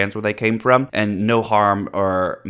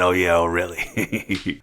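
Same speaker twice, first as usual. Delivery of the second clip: teasing comeback; 4 semitones lower, much slower than usual. Retold or imitated. Teasing comeback to his challenge.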